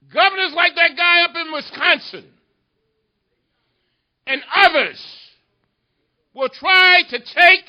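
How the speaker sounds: high, loud preaching cadence; fiery rally oratory. Real, not acted. Congressman urging the audience to fight, full-force oratory. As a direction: hammer it out to the crowd, phrase by phrase.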